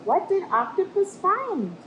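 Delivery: big high gasp, then a teasing question; storybook gasp. Acted. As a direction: build suspense for young listeners. Reader pauses story with question.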